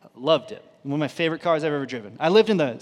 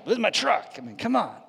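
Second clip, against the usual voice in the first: higher-pitched mock indignation; retold anger; 6 semitones higher, faster than usual. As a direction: protest like an affronted owner, half in jest. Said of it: "I was so angry".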